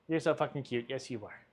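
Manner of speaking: very soft doting pet talk. Real, not acted. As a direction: coo lovingly at the cat. Cooing to the cat.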